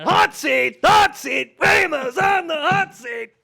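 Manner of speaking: very high, loud drawn-out holler; hammed-up announcer shout. Host putting Waymos on the hot seat, high mock shout. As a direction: belt it out like a segment jingle.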